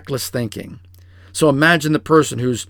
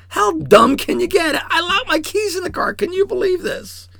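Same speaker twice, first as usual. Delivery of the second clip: high, strained mock outburst at yourself; exasperated self-scolding. Berating yourself for locking the keys, much higher and strained. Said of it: "What did you say to yourself?".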